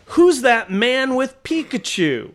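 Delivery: put-on high, drawn-out kid's call; excited little kid. Little kids passing the booth, high excited question. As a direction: imitate a child pointing something out. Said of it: "most little kids, when they walk by, go".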